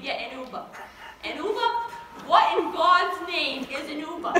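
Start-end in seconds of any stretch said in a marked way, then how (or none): 1.2-1.7 high, loud rising question; incredulous echo. Acted. Grandma baffled by the word. 2.3-4.3 loud, slow, exasperated question; indignant bafflement. Acted. Grandma outraged at unknown thing.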